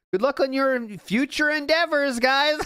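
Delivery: high-pitched mock well-wishing; mocking sign-off. Mocking the streamer's awkward sign-off, high teasing voice.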